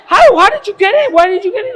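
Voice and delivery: high, loud, with a laugh breaking through; frantic high-pitched disbelief; alarmed questioning. Retold or imitated. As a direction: fire the questions at him in disbelief. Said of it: "And I'm like".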